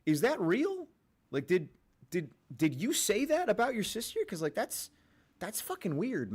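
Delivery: high-pitched, halting disbelief; mock incredulous. Streamer questioning a pundit about his sister, mock incredulous.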